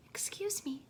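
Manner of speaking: 1 timid sparrow. A narrator's reading. Shy sparrow speaks up.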